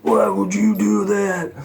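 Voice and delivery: high, slow, drawn-out mock outrage; mock raging scream. Duped singer hearing his contract is sold, mock raging. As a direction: imitate a screaming tantrum, not at full volume. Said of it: "he started screaming, raging".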